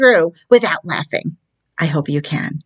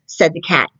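usual speaking voice

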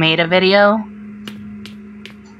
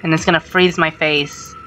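ordinary delivery

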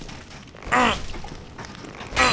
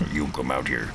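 deep villain voice; 13 semitones lower, 4 dB quieter than usual. Pretend. Voicing the staring enemy.

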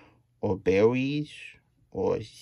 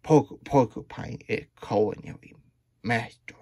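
plain reading voice

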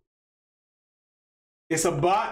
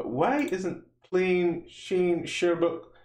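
ordinary talk

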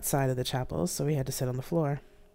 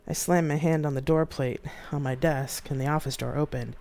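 ordinary delivery, nothing marked

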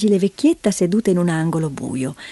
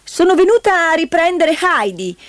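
high, brisk announcement; high-pitched, self-important aunt; brash aunt; 9 semitones higher, 3 dB louder than usual. A narrator's reading. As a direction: barge in and declare it. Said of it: "disse irrompendo nella stanza".

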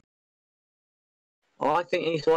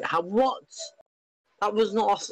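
surprised; 9 semitones higher than usual. Real, not acted. Protesting offside call.

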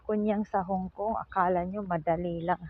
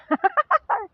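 high laugh. Real, not acted. Laughing at own joke.

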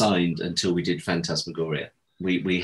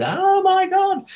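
squeaky, drawn-out panicked wail; hammed-up terror, voice shooting up high; mock-panicked falsetto wail; 6 dB louder, much slower than usual. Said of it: "went into full panic mode".

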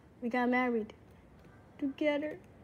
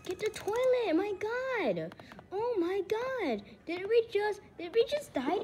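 urgent whining; 6 semitones higher than usual. Real, not acted. Player reacting to dying in the game, urgent whining. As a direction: plead urgently, pitch riding high.

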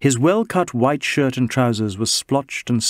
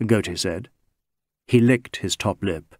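usual speaking voice

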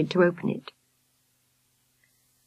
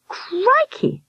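muttered amazement. A narrator's reading. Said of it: "muttered Victoria".

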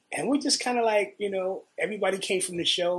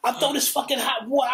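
raised, louder mock threat; acted-out heated outburst; angry threat; 6 semitones higher, 6 dB louder than usual. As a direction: imitate a bandmate threatening in anger. Acted threat in retold band quarrel.

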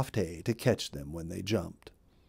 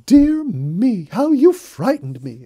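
high, loud startled rabbit cry; frightened exclaim; 11 semitones higher, 11 dB louder, slower than usual. A narrator's reading. Molly startled by Bluebird's shadow, high frightened cry. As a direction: gasp it out like a scared little rabbit. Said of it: "exclaimed Molly".

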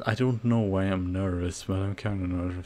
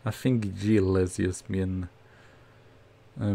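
ordinary talk, nothing marked

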